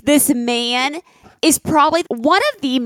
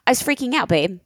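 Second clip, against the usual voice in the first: ordinary talk, nothing marked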